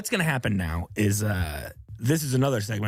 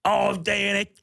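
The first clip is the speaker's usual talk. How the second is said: crazy voice; 9 semitones higher, slower than usual. Acted. Banshee role-play at wedding.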